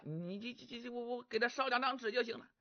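先压低声音支吾，再加快抢着说完; 心虚得话都说不利索，赶紧找补; 慌乱结巴. Acted. 李玄慌乱搪塞. (李玄被程咬金追问，心虚地支吾搪塞)

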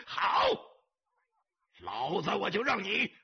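high, blustering shout; rising, swaggering tough-guy roar; loud boast. A narrator's reading. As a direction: bellow it as a threatening promise. Boss loudly accepts the challenge.

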